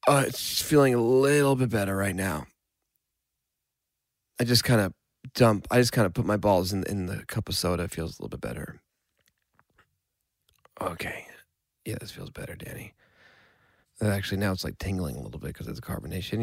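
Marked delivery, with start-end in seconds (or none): 4.4-12.9 soft, breathy relieved whisper. Pretend. Soothing burn in soda cup. 14.0-16.4 hushed. Pretend. Continued relief, hushed.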